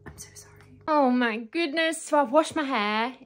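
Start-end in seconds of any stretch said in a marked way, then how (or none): 0.0-0.5 soft, unvoiced whisper; late-night whisper. Real, not acted. Whispering an apology to viewers late at night. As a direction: hush it so nobody wakes.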